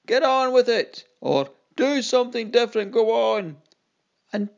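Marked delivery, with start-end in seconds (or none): 0.1-1.1 higher, louder call from the crowd; impatient heckle. Acted. Restless crowd heckles the clown, a loud impatient call. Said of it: "those that were calling on". 1.8-3.6 higher and louder, urging jeer; heckling call. Acted. Bored audience member urges the clowns, a loud jeering call. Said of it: "those that were calling on".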